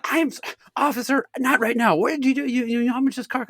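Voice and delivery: high-pitched imitation of a scolding mother; frantic, acted telling-off; mom reaming out. Mom reaming out son in cop car, imitated scolding. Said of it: "reaming me out".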